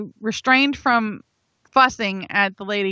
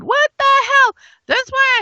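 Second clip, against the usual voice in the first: exasperated; 5 dB louder than usual. Real, not acted. Frustrated with bank passcode.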